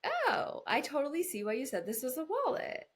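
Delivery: high, loud, eager understanding. Retold or imitated. Partner validating the other view, high and eager. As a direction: gush to see their side. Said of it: "come over to their side and be like".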